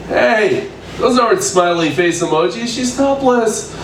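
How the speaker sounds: ordinary delivery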